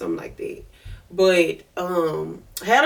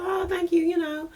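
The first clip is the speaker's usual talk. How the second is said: mock polite thanks; 10 semitones higher, 4 dB quieter than usual. Polite reply to creepy compliment.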